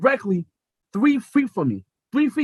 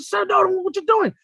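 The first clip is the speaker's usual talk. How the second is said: much higher, panicked; panicked plea; 10 semitones higher than usual. Retold or imitated. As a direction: beg them to stop in a rising panic. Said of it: "I said".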